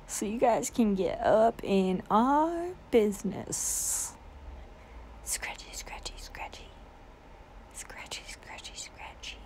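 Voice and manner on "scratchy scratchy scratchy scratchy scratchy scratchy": soft whisper while scratching. Real, not acted. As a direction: whisper it to the dog. Scratching the dog.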